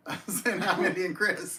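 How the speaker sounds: talking through a laugh; amused, laughing as you explain the slip. Real, not acted. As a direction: giggle through the words. Laughing at own greeting mix-up.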